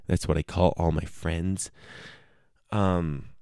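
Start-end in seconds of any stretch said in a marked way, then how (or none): none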